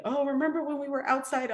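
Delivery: lightly voiced kid reminiscing; imitating a child. Little ones coming back to visit.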